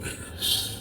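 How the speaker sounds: whispered shush; hissing at him to keep quiet; hushing whisper. Retold or imitated. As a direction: hush him without being heard. Hushing him near the officer.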